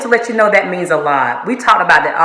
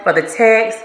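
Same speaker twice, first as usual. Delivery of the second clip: ordinary talk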